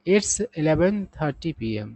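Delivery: very slow, word by word English; slow example sentence. Shown as a demonstration. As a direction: read it out slowly for learners. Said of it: "कह रहा है".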